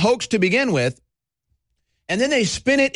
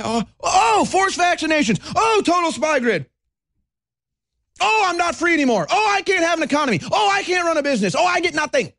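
higher, quick mock wailing list; mock whining; 8 semitones higher than usual. Host mimicking a panicked citizen to viewers, higher mock wail. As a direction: whine each loss like a panicked victim.

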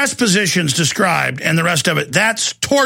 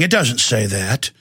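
mock calm smugness; 7 semitones lower than usual. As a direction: lower and slow, with a smug power-trip calm. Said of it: "very calmly saying".

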